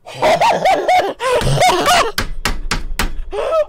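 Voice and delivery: high loud screaming laughter; real overjoyed high-pitched cackle; ecstatic shrieking laugh. Real, not acted. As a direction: burst into a shrieking laugh of joy. Loot dropped, ecstatic.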